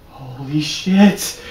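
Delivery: very slow, drawn-out gasp of awe; awed disbelief. Retold or imitated. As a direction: stretch every syllable in shock. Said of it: "I'm like".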